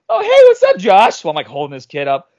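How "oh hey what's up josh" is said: very high, loud cheery greeting; hammed-up friendly hello mid-fight; sudden friendly switch. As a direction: flip straight from screaming to casual hello. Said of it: "I can turn it off, like, a flip of a switch".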